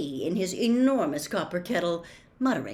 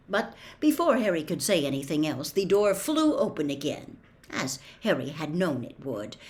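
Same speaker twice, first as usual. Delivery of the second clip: usual speaking voice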